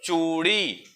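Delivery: चूड़ी with the oo drawn out; slow demonstration of चूड़ी's long vowel. Shown as a demonstration. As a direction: hold चू long in चूड़ी, then let it fall. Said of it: "चूड़ी को थोड़ा लंबा लेके गया".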